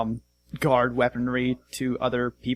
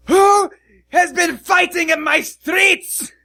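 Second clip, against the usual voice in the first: booming giant roar, high and loud; thundering shout; 8 dB louder, slower than usual. Acted. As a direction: bellow it like a furious giant. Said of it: "big thundering".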